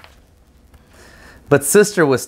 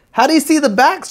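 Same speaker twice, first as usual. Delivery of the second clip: put-on curious little girl; faster than usual. A narrator's reading. Little sister asks dentist curiously.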